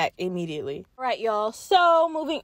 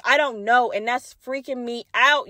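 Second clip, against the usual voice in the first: usual speaking voice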